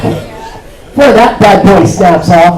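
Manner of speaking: ordinary delivery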